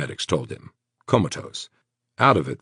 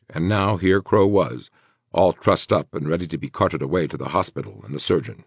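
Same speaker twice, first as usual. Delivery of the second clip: ordinary delivery, nothing marked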